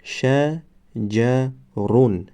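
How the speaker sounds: "قمرون" drawn out syllable by syllable; slow demo word. Shown as a demonstration. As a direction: stretch "قمرون" slowly for the class. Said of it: "دیگرش". ("قمرون" آهسته و کشیده برای شاگردان)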